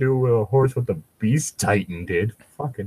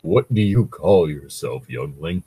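slow, deep ancient Ent; ancient tree voice; 9 semitones lower than usual. Acted. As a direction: rumble it like an old tree. Ent character voice.

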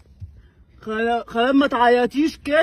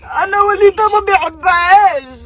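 crying; 9 semitones higher, 4 dB louder than usual. Acted. Whiny crying complaint.

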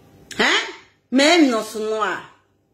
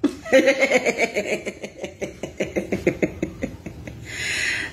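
breathy laughing; 2 semitones lower, 5 dB quieter than usual. Real, not acted. Long laugh.

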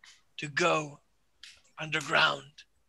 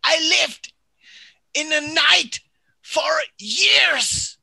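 whisper yelling; 7 semitones higher than usual. Acted. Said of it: "Are you yelling this?".

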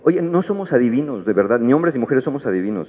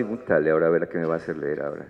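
much lower, softer, fast grumble; stay-at-home partner dreading the book; grumbling aside; 8 semitones lower, 6 dB quieter, faster than usual. Acted. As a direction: grumble it under your breath.

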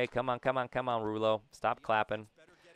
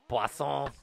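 relished French pronunciation, a little higher; French accent; 4 semitones higher than usual. Shown as a demonstration. Player savours a batter's surname, French accent. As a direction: say Poisson as "pwah-sohn", in French. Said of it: "say in a French accent".

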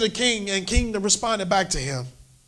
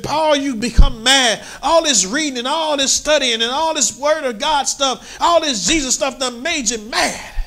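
higher, louder charge that Paul is mad; loud accusing Festus; 6 semitones higher than usual. Acted. Festus loudly telling Paul he is mad. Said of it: "with a loud voice".

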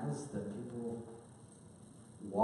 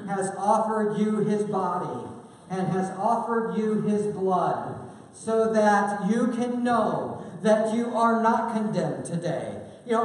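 impassioned preaching; 11 semitones higher, 12 dB louder than usual. Acted. Pastor calling the church to the table, impassioned and loud. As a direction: proclaim it over the table with fervor.

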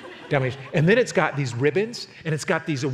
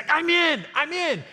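eager quote; 12 semitones higher, slower than usual. Retold or imitated. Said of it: "she goes".

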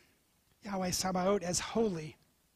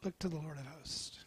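hushed; 5 semitones lower, 13 dB quieter, faster than usual. Acted. Climactic exhortation, hushed.

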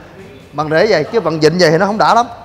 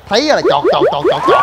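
squeaky clicks, pitched way up; mock clicking noises; 10 semitones higher than usual. Showing how he clicks to order machines, high squeaky chọt chọt. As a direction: mimic frantic button clicking.

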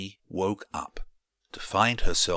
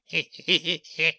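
sly bird laugh; 9 semitones higher than usual. Acted. Crook the Rook appears.

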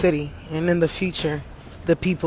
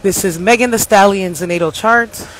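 announcer voice; 3 semitones higher, 5 dB louder than usual. Acted. Announces chart like a broadcast.

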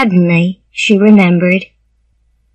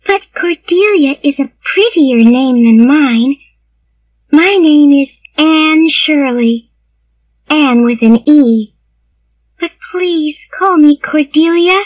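put-on high little-girl voice, wistful; sad, pleading child; 7 semitones higher than usual. A narrator's reading. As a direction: plead as a small orphan girl. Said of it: "said the child sadly".

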